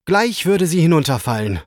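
usual speaking voice

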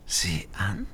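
softly whispered, amused and sly; playful burglar whisper. A narrator's reading. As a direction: breathe it out like a sly witch. Witch teasing the caught thief.

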